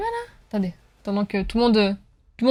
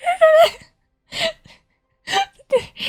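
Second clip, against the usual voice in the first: high, squeaky Québécois-imitation regret through laughter; helpless high-pitched laughing speech; 10 semitones higher than usual. Real, not acted. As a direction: barely get it out for laughing. Cracking up at own failed accent.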